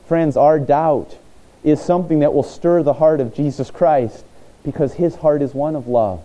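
normal talking voice

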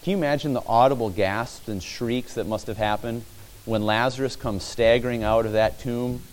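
ordinary talk, nothing marked

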